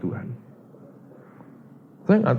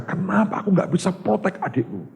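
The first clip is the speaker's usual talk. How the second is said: angry at himself; 10 semitones higher than usual. Retold or imitated. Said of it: "Dia juga marah ke dirinya".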